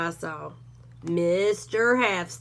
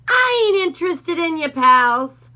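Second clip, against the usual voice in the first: higher, louder, threatening bark; a bully dog snarling at Hank; menacing Doberman; 7 semitones higher, 7 dB louder than usual. A narrator's reading. Rufus voice.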